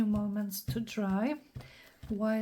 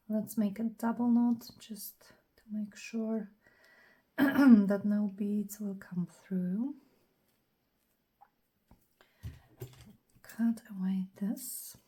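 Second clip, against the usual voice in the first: whispering. Acted. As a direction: whisper close to the mic, unhurried. Whispered crafting commentary.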